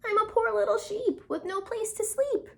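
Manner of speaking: high, sugary fake lamb voice; wolf faking a sweet lamb; wolf in a put-on sweet high voice; sweet little voice. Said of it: "in a sweet little voice".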